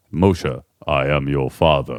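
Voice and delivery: low, slow mock Darth Vader rumble; James Earl Jones. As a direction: intone it like the famous movie villain. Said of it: "James Earl Jones saying that".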